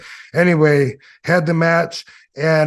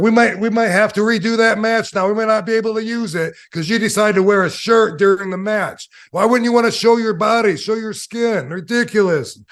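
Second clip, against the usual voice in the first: upset; 7 semitones higher than usual. Retold or imitated. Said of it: "he was upset".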